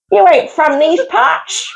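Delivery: put-on slow, drawled rural local accent; local yokel. Local greeting a stranded driver, slow rural drawl. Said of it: "I feel like my line still would have worked".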